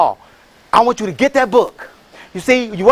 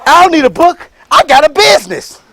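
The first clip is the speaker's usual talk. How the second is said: louder, higher, brushing the book off; swaggering dismissal of reading; cocky retort; 5 semitones higher, 5 dB louder than usual. Retold or imitated. To McDonald's advice, a cocky louder brush-off. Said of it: "I was like".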